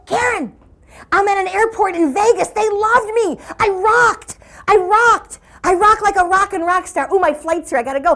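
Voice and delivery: hammed-up triumphant call; mock excited bragging. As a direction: imitate an overexcited caller crowing. Excited kid calls from airport.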